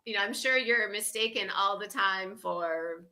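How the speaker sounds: normal talking voice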